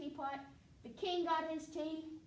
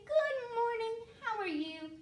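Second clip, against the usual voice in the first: cheery greeting; 10 semitones higher, 6 dB louder than usual. Retold or imitated. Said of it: "You would just say".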